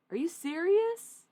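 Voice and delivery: high, loud, slow stunned exclamation; shocked disbelief. Real, not acted. Reacting to a spirit reading, loud shocked disbelief. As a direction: blurt it out in sheer disbelief.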